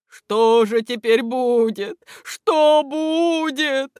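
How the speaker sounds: slow, loud, rising plea; тревожный вопрос. A narrator's reading. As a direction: ask in drawn-out alarm. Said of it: "вопрошали Л". (протяжно, с тревогой вопрошать)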